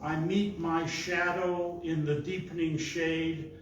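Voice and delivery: measured, projected poetry reading; poem recitation. Acted. Reading a poem his daughter loves, measured recitation. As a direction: recite it deliberately, leaning on the key words.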